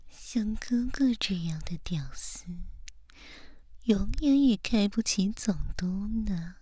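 usual speaking voice

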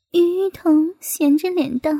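usual speaking voice

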